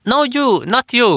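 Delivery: very high, loud fan voice; fan correcting her. Retold or imitated. Fan woman telling her she is not wanted, very high and loud. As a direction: brush her aside like an eager fan.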